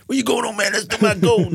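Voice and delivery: very high, hammed-up movie-villain voice; mock gangster shout-after. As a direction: call after a thief in a put-on high voice. Voicing wounded gangster calling after man.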